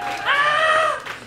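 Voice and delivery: sudden high squeal of delight; high-pitched excited shriek; excited yelp. Acted. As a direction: squeal like a fan spotting a friend. Excited gasp at fan reaction.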